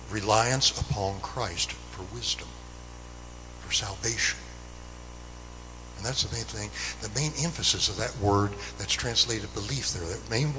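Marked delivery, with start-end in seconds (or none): none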